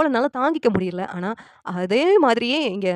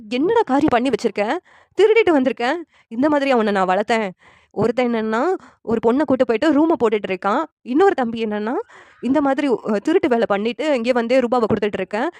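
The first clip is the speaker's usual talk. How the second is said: raised voice, louder on the accusing questions; acted outburst of a reproachful elder brother; angry scolding; 3 semitones higher, 4 dB louder than usual. Elder brother scolds after slapping him.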